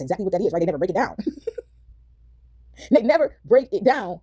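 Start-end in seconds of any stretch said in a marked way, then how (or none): 2.9-4.2 drawn out at half speed, word by word; slow emphatic repeat. Real, not acted. Hammering home to viewers what is never explained, slow and emphatic. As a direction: spell out "They never break it down" very slowly.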